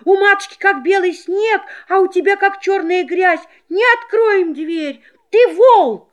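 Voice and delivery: high, shrill-free small shout; kid goats shouting. Acted. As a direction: cry out like little goats. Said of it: "да как закричат".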